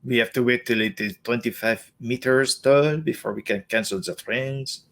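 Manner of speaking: badly faked French Canadian accent; French Canadian impression, dragging out the ending. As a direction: put on a hammy French Canadian voice. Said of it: "my French Canadian voice".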